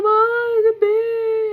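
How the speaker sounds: high, loud mock wail; hammed-up tearful shriek, imitating daughter; imitated scream-crying. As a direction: wail like a terrified crying child. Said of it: "scream crying".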